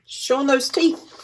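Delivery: usual speaking voice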